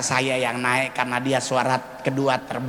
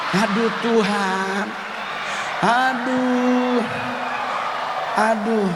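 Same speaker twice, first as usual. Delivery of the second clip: mock anguished groan; 6 semitones higher, slower than usual. Mortified groaning at the memory.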